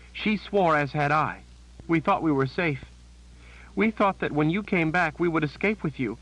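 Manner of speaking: high, shaken voice of a terrified sister; a scared woman blurting out bad news; frightened Janet. A narrator's reading.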